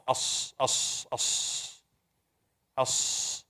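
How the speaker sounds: As hissed with no voice; hissed as sound. Shown as a demonstration. Teacher models the letter sound with a soft hiss. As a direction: hiss As softly, no voice. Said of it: "look at the diagram".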